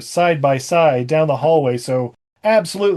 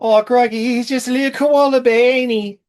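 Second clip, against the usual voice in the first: mock Aussie accent; 7 semitones higher than usual. Mock Aussie wildlife host.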